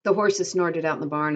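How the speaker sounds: ordinary delivery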